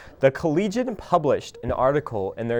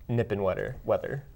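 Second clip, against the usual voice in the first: tongue-tied from the cold; cold numb lips; 4 semitones lower, 8 dB quieter than usual. Real, not acted. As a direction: lips too frozen to speak right. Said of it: "I can't talk 'cause of the cold".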